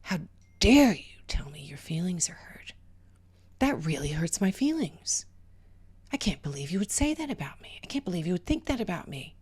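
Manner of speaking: whispered mock outrage; mock offended person. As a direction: play the wounded accuser in a whisper. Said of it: "You say something like".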